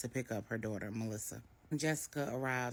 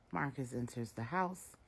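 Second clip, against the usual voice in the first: plain reading voice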